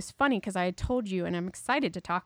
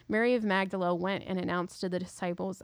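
ordinary talk, nothing marked

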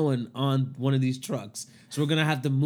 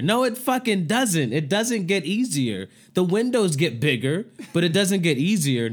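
heated rant; 6 semitones higher, 5 dB louder than usual. Real, not acted. Heated rant against the advice.

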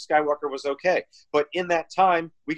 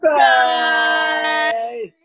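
loud, high farewell shout; shouted goodbye; 9 semitones higher, 8 dB louder, much slower than usual. Real, not acted. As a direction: yell it out as a send-off. Shouted sign-off.

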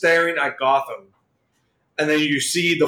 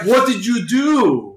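mock shocked cry; 7 semitones higher, 3 dB louder, slower than usual. Villains shocked at the killing.

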